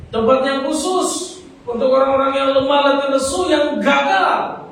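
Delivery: high, loud preacher's cry, pressing the congregation; fiery preaching. Acted. Pastor urging the weary congregation, fiery preaching. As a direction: preach it at full force to the weary.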